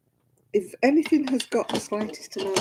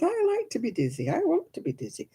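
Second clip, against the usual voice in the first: put-on high-pitched little-girl insistence; imitated defiant child; 10 semitones higher than usual. Little girl told not to spin, imitated high insistence. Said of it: "And she goes".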